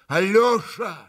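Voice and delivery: urging his brother to run after her; urgent call. A narrator's reading. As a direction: hurry your brother off. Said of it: "стремительно обратился к брату Митя".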